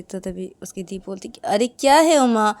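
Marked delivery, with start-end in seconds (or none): 1.4-2.5 loud, high, exasperated comforting; insistent, raised-voice sisterly fussing; chiding big sister. Acted. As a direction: scold her out of crying. Big sister chides the crying girl.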